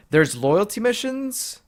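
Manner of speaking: high, slow delighted question; thrilled disbelief; excited. Real, not acted. Gamer thrilled at feature.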